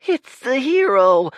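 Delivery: very high, loud excited cry; cried out. Acted. Urchin crying out to the crowd, very high and loud. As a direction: shout to the whole crowd. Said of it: "cried the urchin".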